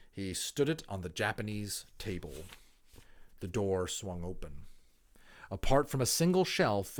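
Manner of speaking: put-on clear, lively reading of a children's book; expressive storybook narration; story narration. A narrator's reading. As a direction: read it aloud like a storyteller to listeners. Reads a children's novel aloud.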